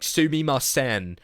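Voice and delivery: loud, slow, hammed-up American-accented Japanese; mock a cliche American tourist accent, loud and drawn out. Streamer showing a bad accent, exaggerated cliche American accent. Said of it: "the cliche American accent trying to".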